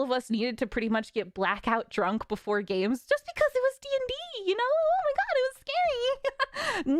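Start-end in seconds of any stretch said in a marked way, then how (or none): 3.0-6.9 very high, mocking disbelief; mock incredulous. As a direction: ridicule the excuse in a high voice. Sarcastic mock of D&D being scary.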